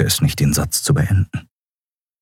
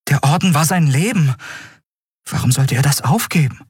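high-pitched, incredulous protest; stunned disbelief; 8 semitones higher than usual. A narrator's reading. Endriel to the admiral about someone leaving the Order, disbelieving. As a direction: protest as if it cannot be true. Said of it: "Endriel starrte ihn fassungslos an".

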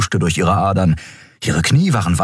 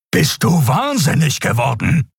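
angry outburst; 8 semitones higher than usual. A narrator's reading. Keru confronts Endriel.

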